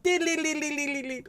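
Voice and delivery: high rattling diddly-ly trill; mouth-trill noise. Shown as a demonstration. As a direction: rattle your tongue quickly. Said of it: "hence why I just went".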